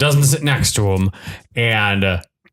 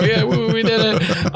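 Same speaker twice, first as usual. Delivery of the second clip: mock celebration; 10 semitones higher than usual. Con artists celebrating.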